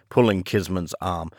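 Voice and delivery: usual speaking voice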